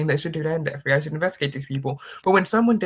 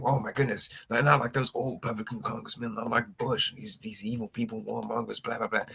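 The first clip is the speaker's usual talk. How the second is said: lower, softer, dismissive parody of the hand-wringing; mocking voice; 6 semitones lower, 6 dB quieter than usual. Mocking the site's writers about old Republicans, lower and softer. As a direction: mumble the mock outrage like it's a chore.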